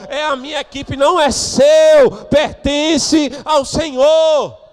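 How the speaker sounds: high, shouted preaching with stretched final words; pregação inflamada. Real, not acted. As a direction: rebuke the congregation at full force. Repreende a igreja pregando. (pregação gritada, aguda e veemente)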